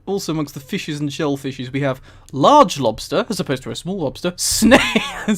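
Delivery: ordinary talk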